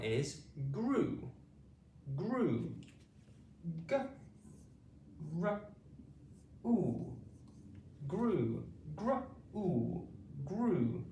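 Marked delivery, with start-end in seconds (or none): 3.9-7.2 "G, R, U" as three separate sounds with pauses between; sounding out. Shown as a demonstration. Teacher breaks grew into its sounds, segmented. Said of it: "blend them together quickly". 9.0-10.1 "gr" blended, then "u" on its own; blended sounding out. Shown as a demonstration. Teacher shows the gr blend to pupils, segmented. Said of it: "blend them together quickly to make the gr sound".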